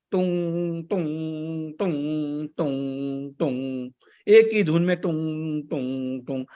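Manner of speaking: pluck a dull guitar string over and over, one note; imitated guitar twang. Shown as a demonstration. Teacher mocks a monotone voice as a guitar, droning tung. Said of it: "जैसे कि कोई guitar बजा रहा है".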